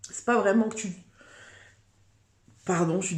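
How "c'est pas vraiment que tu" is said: speech caught by a hiccup; hiccuping mid-sentence. Real, not acted. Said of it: "j'ai le hoquet".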